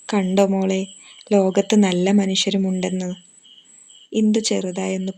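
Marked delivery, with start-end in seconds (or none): none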